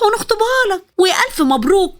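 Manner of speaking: excited mother. A narrator's reading. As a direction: burst out in teasing joy.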